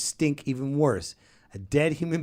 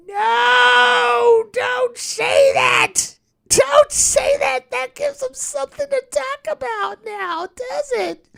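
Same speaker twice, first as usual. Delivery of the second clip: high, loud, pleading shout; desperate shout; 7 dB louder, slower than usual. Acted. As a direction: shout it out in panic. Begging wife not to say it.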